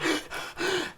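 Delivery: a high, breathy gasp of shock; excited gasp. Retold or imitated. Retold excitement.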